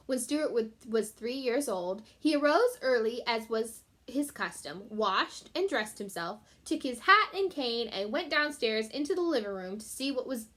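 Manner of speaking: plain reading voice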